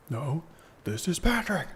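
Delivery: goofy cartoon starfish delivery; Patrick voice. Patrick meme, Krusty Krab joke.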